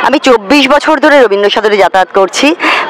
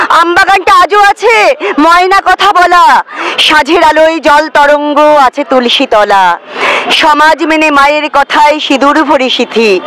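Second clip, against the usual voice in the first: lilting, measured verse with raised pitch; plaintive recitation, lifting and falling with the verse; poem recitation; 5 semitones higher, slower than usual. Acted. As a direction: recite a wistful love poem, pausing after each line. Recites own love poem.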